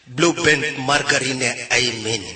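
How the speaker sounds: slow, solemn mock prayer; deadpan grace before a meal; praying. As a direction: intone it reverently, word by word. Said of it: "Mari kita berdoa".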